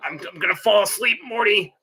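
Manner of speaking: stammering drunk grandpa cartoon voice; Rick. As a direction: stammer along, tacking the name onto phrases. Plays Rick talking to Morty.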